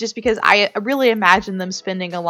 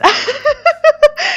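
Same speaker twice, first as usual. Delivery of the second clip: high-pitched giggle; 7 dB louder than usual. Real, not acted. Laughing at her own sentimentality.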